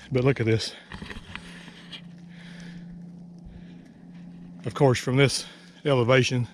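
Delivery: breathless after the climb uphill; winded, catching breath between phrases. Real, not acted. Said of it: "I'm gonna try not to sound like I'm hyperventilating".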